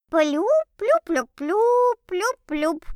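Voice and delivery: tiny high squeaky voice, slow; a little creature squeaking slowly; under-bed monster. A narrator's reading. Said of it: "раздался тоненький голосок Фублика, который, кажется, изображал меня".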